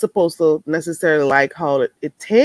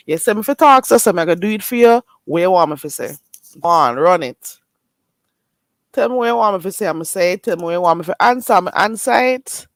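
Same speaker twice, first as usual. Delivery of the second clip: quick Jamaican patois, louder and teasing; 4 dB louder, faster than usual. Pretend. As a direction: switch into patois on request. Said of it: "let me, let me change".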